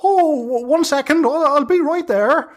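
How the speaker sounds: high, cackly hag calling down from the trees; old hag voice. Acted. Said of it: "she just looks at you and she says".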